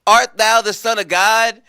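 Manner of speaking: loud, high demand; a touch of mock-grand interrogation; Pontius Pilate. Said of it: "Pontius Pilate was like".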